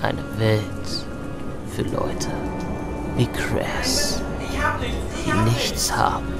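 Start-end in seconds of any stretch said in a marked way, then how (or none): none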